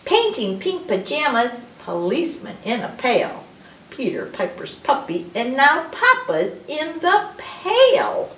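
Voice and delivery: bouncy alphabet rhyme, hitting each P; reading to children. Acted. Reads an alphabet rhyme aloud.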